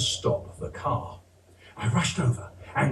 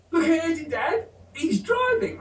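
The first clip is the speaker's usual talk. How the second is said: put-on higher child's voice telling on his brother; 13 semitones higher than usual. Older brother telling his dad about the toddler, higher. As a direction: pitch it up like a giggling seven-year-old.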